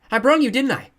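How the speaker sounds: put-on high, loud eager child; demanding confirmation. A narrator's reading. Matt asking the blind man, eager. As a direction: insist like a proud toddler. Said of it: "demanded confirmation".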